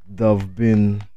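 normal talking voice

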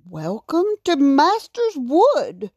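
lightly voiced welcoming king. A narrator's reading.

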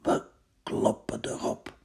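hushed, breathy-free whisper. Acted. Odysseus whispers the attack plan to his men, hushed. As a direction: whisper the order.

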